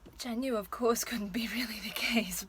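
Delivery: amused, laughing admission. Acted. Actress admits the bear wasn't really looking at her, laughing.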